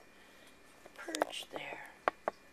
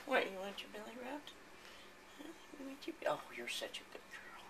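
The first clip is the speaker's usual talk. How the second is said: soft cooing pet talk, partly whispered; 3 semitones higher, 11 dB quieter than usual. Real, not acted. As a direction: coo softly to a cat you love. Cooing to her cat.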